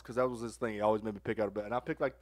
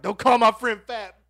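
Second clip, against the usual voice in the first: high, loud, drawn-out retort; indignant yell; 11 semitones higher, 13 dB louder, slower than usual. Retold or imitated. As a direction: re-enact yelling in a friend's defence. Yells back while throwing turd.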